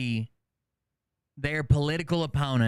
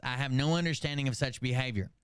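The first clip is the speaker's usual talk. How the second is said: normal talking voice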